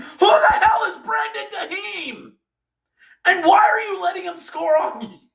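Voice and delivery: high, cracking exasperated cry; outraged disbelief. Real, not acted. Fan demanding why his team let Duhaime score, anguished. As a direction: wail it at the team. Ranting about unknown scorer.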